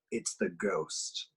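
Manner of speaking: low, quiet, slow reveal; ominous. A narrator's reading. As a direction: drop it low and dark.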